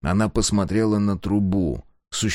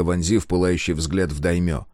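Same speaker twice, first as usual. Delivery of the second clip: ordinary delivery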